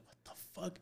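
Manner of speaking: hushed, whispery disbelief; imitated shock, barely voiced; retold stunned reaction. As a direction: say it under your breath, aghast.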